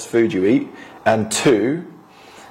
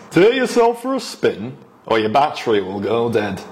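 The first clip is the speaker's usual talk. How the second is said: northern accent. Shown as a demonstration. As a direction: have a go at a northern English accent. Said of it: "can I have a go?".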